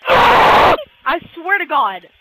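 slow, loud, falling wail; real frustrated outburst; exasperated shout. Real, not acted. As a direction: howl it, drawing it out. Exasperated with the game.

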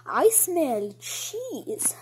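very slow, drawn-out savouring; sneaky mouse voice. Acted. Mouse smelling the bait, slow and drawn out. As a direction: stretch each word like a sniffing creature.

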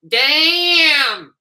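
high, loud drawn-out exclamation; Chris Tucker shout. Retold or imitated. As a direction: belt it out like a stunned comic sidekick. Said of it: "Chris Tucker goes".

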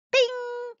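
sharp high metallic ping; a bright high bing. Acted. Sound of dart bouncing.

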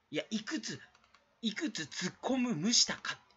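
brisk, pushy tsukkomi in fake Kansai dialect. YouTuber jabbing at a streamer's clips in fake Kansai dialect. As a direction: roast each clip like a Kansai comic, in put-on Kansai dialect. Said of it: "エセ関西弁でやってしまって".

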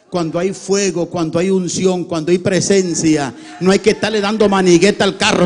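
loud, rhythmic revival preaching; full-force preaching. Real, not acted. Preacher contrasting true fire with cranking an old car, loud preaching. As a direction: drive each phrase home at full volume.

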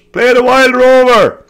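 high, loud, drawn-out imitated yell; heckler shouting out. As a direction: holler a song request from the middle of the crowd. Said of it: "in the middle just shouting out".

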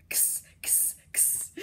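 whispered "Kss, kss"; voiceless x sound. Shown as a demonstration. Teacher models the x sound for kids. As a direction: hiss the ks. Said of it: "Almost there".

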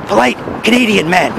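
low, gravelly hero introduction; Polite Canadian Man. Acted. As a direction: announce yourself in a mock Batman growl. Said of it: "keep your voice low and gravelly".

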